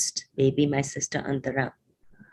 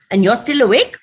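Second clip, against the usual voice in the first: loud, higher-pitched scolding questions; 9 semitones higher, 10 dB louder than usual. A narrator's reading. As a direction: scold like a mother catching you up late. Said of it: "she scolds".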